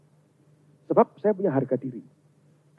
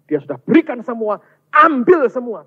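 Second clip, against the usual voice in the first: high, loud demand as the accuser; Satan accusing; 10 semitones higher, 8 dB louder than usual. Acted. Satan pleading his case before God, loud high demand. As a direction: press the accusation up high and louder. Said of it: "Setan berkata".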